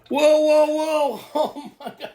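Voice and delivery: real laughing astonishment; laughing surprise. Real, not acted. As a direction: caught off guard and cracking up. Reacting to the reading.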